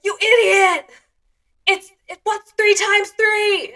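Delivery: high, loud mock-furious shout; angry dad yelling. Dad grilling a child on times tables, angry mock yell.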